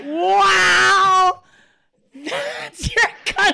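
high laughing shout of disbelief; loud, amazed and cracking up; shocked. Real, not acted. Shocked by friend's confession.

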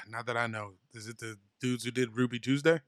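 ordinary talk, nothing marked

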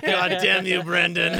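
laughing fake fury; mock curse. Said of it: "I'm like".

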